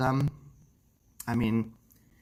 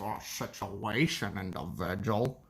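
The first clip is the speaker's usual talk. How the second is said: Brett Keane impression; 3 semitones lower, 5 dB quieter than usual. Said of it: "Everyone can do a Brett Keane".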